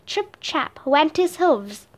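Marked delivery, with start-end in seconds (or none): none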